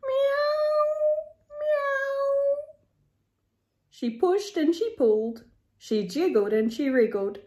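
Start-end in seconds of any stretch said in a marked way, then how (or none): 0.1-2.6 two high, wailing meows; moaning meow. A narrator's reading. Narrator voices stuck cat wriggling in the door, moaning meows. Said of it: "She moaned and she meowed".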